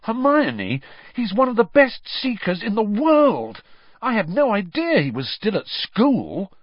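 high, loud, eager fan voice; gushing about his hero; excited. A narrator's reading. Ron gushing to Hermione about Krum, high and excited.

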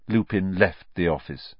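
ordinary delivery, nothing marked

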